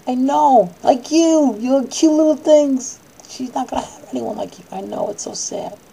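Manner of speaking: put-on high cooing voice to little kids; high sing-song baby talk. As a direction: coo at them like cute little things. Cooing at the children.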